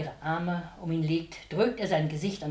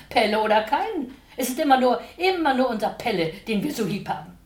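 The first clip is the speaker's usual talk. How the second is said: emphatic; 5 semitones higher, 7 dB louder than usual. Acted. Mama insists emotionally.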